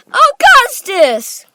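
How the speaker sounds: high, loud, drawn-out cry; Mister Wonka. A narrator's reading. Factory owner crying out to Augustus, high and loud. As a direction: cry the name in alarm. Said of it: "cried Mister Wonka".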